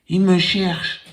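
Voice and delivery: put-on high little boy voice. Small boy telling caller everyone is busy, high child voice. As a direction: answer like a small child, matter-of-fact.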